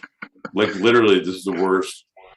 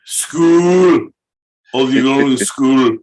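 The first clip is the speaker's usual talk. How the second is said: put-on Scottish accent; 3 semitones higher, 5 dB louder, slower than usual. Host echoing "going to school", exaggerated Scottish accent. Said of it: "I wanna do Scottish".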